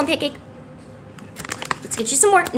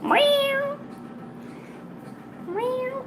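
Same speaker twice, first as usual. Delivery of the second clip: high, small mock meow; imitated meow; 14 semitones higher, 8 dB quieter than usual. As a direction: mimic a cat in a high, softening voice.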